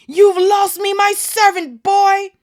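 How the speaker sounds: high, loud snarling rage; furious Malfoy. Acted. Malfoy snarling at Harry as he lunges, furious. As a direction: lunge at the boy with fury.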